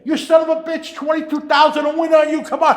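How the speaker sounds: high, loud cursing at a horse; imitated screaming. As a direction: imitate a frantic bettor yelling it on. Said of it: "Angel's screaming on the other end".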